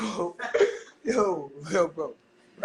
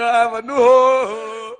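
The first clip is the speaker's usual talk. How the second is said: slow, louder boast; loud brag; 8 dB louder, slower than usual. Real, not acted. Streamer bragging loudly to chat about his new girl. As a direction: belt it out proudly.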